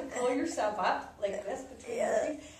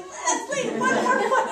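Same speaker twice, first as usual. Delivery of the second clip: high, raised mock call from below; imitated urging kids; 11 semitones higher, 7 dB louder than usual. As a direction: imitate kids egging someone on to keep going. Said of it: "They're going".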